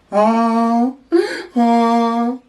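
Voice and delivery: donkey bray. Shown as a demonstration. Animal sound for toddler.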